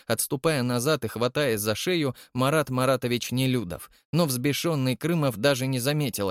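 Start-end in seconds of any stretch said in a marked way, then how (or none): none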